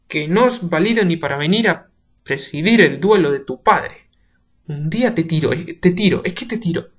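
loud shouting threat; shouted menace; furious threat. Acted. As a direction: roar the threat. Threatening to throw him over.

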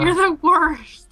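slow, loud, high exaggerated "you're the worst"; drawn-out mock outrage. Real, not acted. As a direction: stretch it out in playful disgust. Teasing friend over food.

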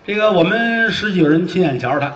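ordinary delivery

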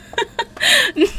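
high-pitched cackling laugh; real laughter. Real, not acted. Player tickled by the squeaky voice, laughing hard. Cracking up at character's voice.

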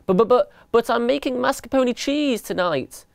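high, pleading protest; flustered stammer. A narrator's reading. Elephant dad objecting to the mice taking his fridge, flustered stammer. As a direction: stutter the start, then plead.

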